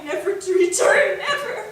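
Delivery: sob on never. Acted. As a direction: voice cracking into a sob. Despair over lost life.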